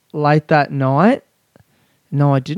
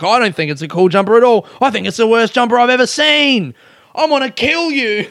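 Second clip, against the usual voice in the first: imitated comic rant; 8 semitones higher than usual. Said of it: "He's like".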